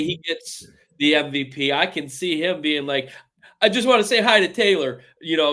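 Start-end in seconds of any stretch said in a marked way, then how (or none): 3.6-5.0 higher, louder, quick player quote; playful mock shout-out. Imagining a player saying hi to Taylor in an interview, mock shout-out. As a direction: toss out an eager, quick hello.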